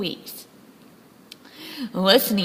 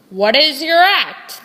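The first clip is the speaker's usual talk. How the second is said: loud, brisk ringmaster question; brusque ringmaster; 4 semitones higher, 6 dB louder than usual. Acted. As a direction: snap it at the auditioner. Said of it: "the ringmaster said".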